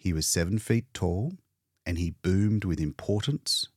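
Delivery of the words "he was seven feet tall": ordinary delivery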